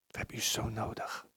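whispered, pleading to God; hushed prayer plea. Real, not acted. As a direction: breathe the plea softly.

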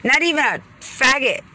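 heated rant. Real, not acted. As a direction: voice climbing as the anger boils over.

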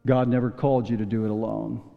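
normal talking voice

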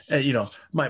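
normal talking voice

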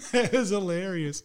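ordinary talk

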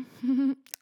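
short jittery giggle; nervous laugh. Real, not acted. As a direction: laugh off the nerves. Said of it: "So that was a really nervous laughter here".

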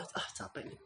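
breathy exhausted sigh; tired sigh. Pretend. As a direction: sigh it out, worn out. Acting out coming home tired.

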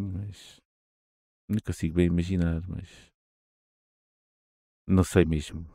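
ordinary talk

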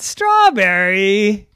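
loud, slow hungry question; greedy interest. Acted. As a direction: savor the word hungrily.

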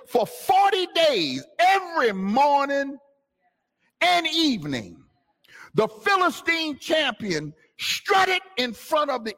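high, slow, declaiming the verse; preached scripture reading. Acted. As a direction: read the verse like a preacher at full force. Preacher reads the verse aloud.

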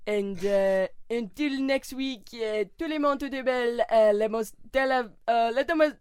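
hammed-up French accent, halting. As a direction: fumble grandly through a mock French sign-off. French-accented sign-off in accent game.

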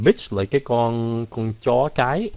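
ordinary delivery

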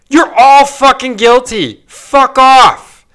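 loud, high, furious yell at politicians; angry outburst. Real, not acted. Ranting at corrupt politicians, loud furious shout. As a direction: let it explode at all of them. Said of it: "Wow, I really went off again".

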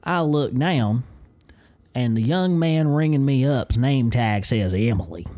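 hammed-up Southern storyteller, running on; Southern accent. Host telling of boiled peanuts, put-on Southern accent. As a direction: spin a country yarn in thick Southern. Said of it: "start leaning into the Southern accent".

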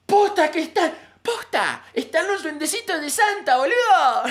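put-on high, loud Argentine girl's squeal; excited Argentine kid yelling the news; Argentine accent. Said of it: "su acento argentino".

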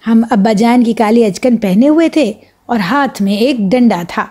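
ordinary delivery

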